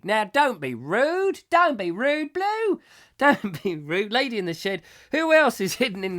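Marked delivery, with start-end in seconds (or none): 0.0-4.1 playful telling-off, rising much higher; host teasing a rude commenter, mock scolding. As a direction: wag a finger at a cheeky viewer in jest.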